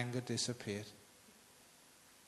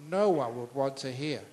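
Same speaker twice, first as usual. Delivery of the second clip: ordinary delivery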